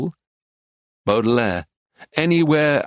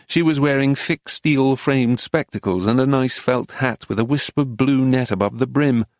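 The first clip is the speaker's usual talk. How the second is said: ordinary delivery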